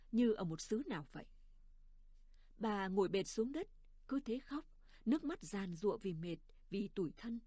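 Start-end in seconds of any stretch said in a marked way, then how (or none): none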